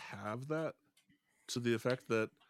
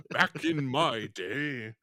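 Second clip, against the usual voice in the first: put-on slow, loud old-timer voice; grumpy old man; 2 semitones higher, 7 dB louder, much slower than usual. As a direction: drag it out like a cranky elder.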